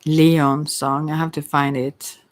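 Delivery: usual speaking voice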